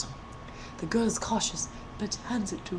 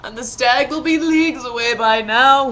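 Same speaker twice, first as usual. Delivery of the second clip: moaning prince; 3 semitones higher, 9 dB louder than usual. A narrator's reading. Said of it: "he moaned".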